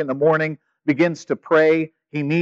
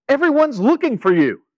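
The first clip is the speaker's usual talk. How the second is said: higher, pointed annoyed tone; exasperated disciples finally finding Jesus; annoyed inflection; 10 semitones higher than usual. Retold or imitated. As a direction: let the disciples' irritation show in the pitch. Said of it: "I said that with an obvious inflection in my voice".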